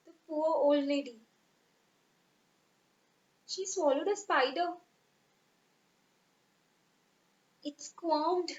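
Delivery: storyteller reading a sad nursery poem; poem recitation. Acted. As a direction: recite it for children, pausing between lines.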